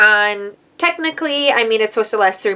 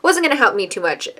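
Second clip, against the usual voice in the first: ordinary delivery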